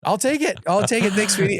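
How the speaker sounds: high-pitched, comic relief; eager acceptance. Retold or imitated. Dad accepting his daughter's grudging forgiveness, eager and high.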